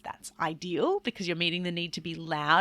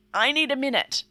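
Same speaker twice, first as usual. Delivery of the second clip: loud announcement; 8 semitones higher, 8 dB louder than usual. Shown as a demonstration. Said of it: "I might say pretty loudly".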